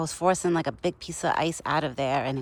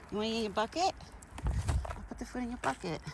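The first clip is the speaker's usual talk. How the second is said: higher, softer voice for the horse; pet talk; 5 semitones higher, 6 dB quieter than usual. Real, not acted. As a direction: coax the horse gently, higher. Feeding her horse.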